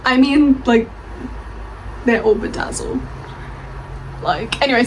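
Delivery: ordinary delivery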